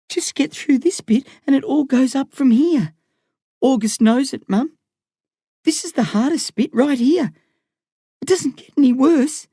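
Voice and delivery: put-on a boy talking his mum through the worst; young boy reassuring. A narrator's reading.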